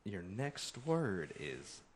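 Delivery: slow, drawn-out bee announcer. Pretend. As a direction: announce the word slowly and grandly.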